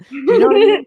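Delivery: high, loud laughing question; laughing at her own remark. Real, not acted.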